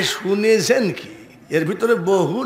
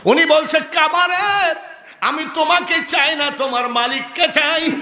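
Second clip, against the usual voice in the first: high, ringing declaration; forceful preaching; 10 semitones higher than usual. Acted. Preacher voicing a saint at the Kaaba, high proclaimed declaration. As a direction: proclaim it in short high bursts.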